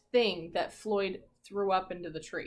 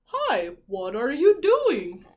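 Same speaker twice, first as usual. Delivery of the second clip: slow, loud, put-on whale voice; a friendly whale calling out; 3 semitones higher, 8 dB louder, slower than usual. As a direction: give the whale a big slow hello. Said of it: "The whale says".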